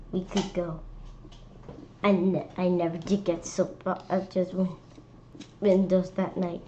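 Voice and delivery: ordinary delivery, nothing marked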